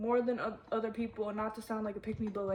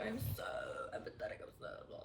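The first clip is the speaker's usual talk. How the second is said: mocking empath; 13 dB quieter, much faster than usual. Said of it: "I'm not gonna sit here and be like".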